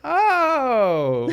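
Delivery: a real burst of tears; crying. Real, not acted. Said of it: "Why are you crying, bro?".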